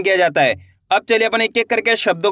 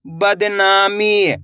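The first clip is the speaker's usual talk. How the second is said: बदनामी drawn out slowly, syllable by syllable, then blended; slow blended reading; much slower than usual. Shown as a demonstration. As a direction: stretch बदनामी out slowly like a teacher. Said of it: "कैसे पढ़ेंगे?". (धीरे-धीरे खींचकर बदनामी)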